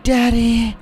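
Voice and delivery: loud, drawn-out creepy moan of the word; hammed-up spooky daddy growl. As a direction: make the word sound as creepy as possible. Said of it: "is just a creepy word".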